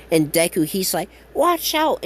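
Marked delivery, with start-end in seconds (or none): 1.3-2.0 sharp high call of alarm, much higher; warning shout. Retold or imitated. Deku yelling to his friends to watch out for the debris. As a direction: yell a quick warning to the others.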